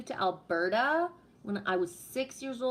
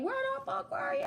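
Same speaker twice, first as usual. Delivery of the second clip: much higher, a mock shrill yell; angry parent; faster than usual. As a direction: scold the missing kid in a screech. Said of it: "a grown-ass adult saying".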